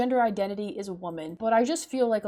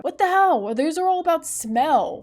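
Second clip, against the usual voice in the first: louder, higher exasperated cry; exasperated outburst; 6 semitones higher, 6 dB louder than usual. Real, not acted. Baffled by the quiz questions, loud exasperated outburst. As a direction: throw up your hands in disbelief.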